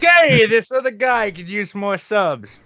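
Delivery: high-pitched, drawn-out mock announcement; mock plug. Pretending to promote Dankula's channel, high and slow.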